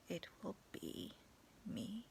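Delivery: ordinary talk, nothing marked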